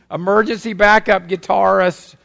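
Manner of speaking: ordinary talk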